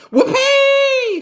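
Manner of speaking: very high, loud witchy whoop; old hag whooping with glee; whooping shout. A narrator's reading. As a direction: let it fly like a giddy old hag. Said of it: "she shouted".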